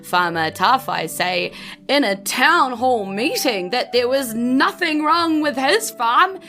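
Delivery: ordinary talk, nothing marked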